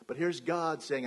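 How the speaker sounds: ordinary talk, nothing marked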